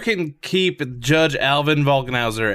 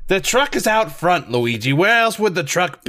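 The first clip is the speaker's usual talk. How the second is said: exasperated Brooklyn Mario, higher; impatient Brooklyn plumber; Brooklyn accent; 7 semitones higher than usual. Acted. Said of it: "my rough Brooklyn accent".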